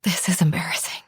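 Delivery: quick, breathy whisper. Acted. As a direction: rush it out on a breath. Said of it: "I said in a breathy voice".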